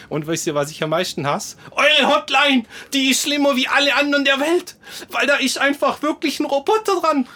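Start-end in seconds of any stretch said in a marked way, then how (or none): 1.7-7.3 high, loud furious complaint; angry rant. Real, not acted. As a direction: rant at the hotline, voice way up. Ranting about the hotline.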